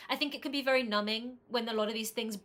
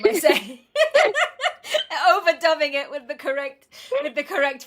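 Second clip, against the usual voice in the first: louder, breaking into real laughter; laughing; 4 semitones higher, 10 dB louder than usual. Real, not acted. Host jokes about dubbing the guest's name, laughing. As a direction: talk through your own laughter.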